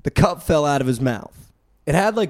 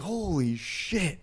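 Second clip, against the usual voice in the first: slow awed gasp; 3 semitones higher, 7 dB quieter, much slower than usual. Retold or imitated. Awed reaction.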